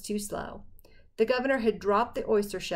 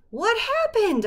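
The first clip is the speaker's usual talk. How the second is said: high, loud alarmed cry; shocked shout from the dark; 13 semitones higher, 6 dB louder, slower than usual. Acted. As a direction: call out in sudden alarm. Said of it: "I cried".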